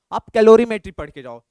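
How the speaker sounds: Calorimetry पढ़ के जाओ called out loud; a loud push to read calorimetry; emphatic advice. Real, not acted. Teacher stresses must-read topic. (ज़ोर से कहा गया कैलोरीमेट्री पढ़ के जाओ)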